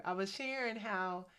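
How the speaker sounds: ordinary delivery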